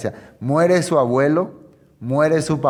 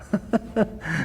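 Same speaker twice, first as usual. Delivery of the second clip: short laugh; 6 semitones higher, 6 dB quieter than usual. Real, not acted.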